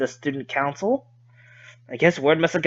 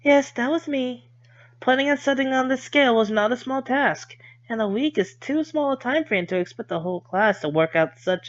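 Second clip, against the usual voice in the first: put-on lightly higher gentle girl voice; polite girl voice, pitched up; Lily voice; 8 semitones higher than usual. Voicing Lily in visual novel.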